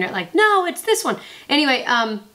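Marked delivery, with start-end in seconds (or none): 0.4-1.2 mock yell. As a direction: yell it like an indignant local. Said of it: "is screaming at the screen".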